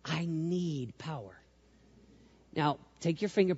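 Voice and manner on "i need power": soft and slow, barely above a whisper; slow soft murmur. Shown as a demonstration. Showing the congregation the prayer, slow and soft. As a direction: let it sink in, slow and hushed. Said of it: "Do just like this and say".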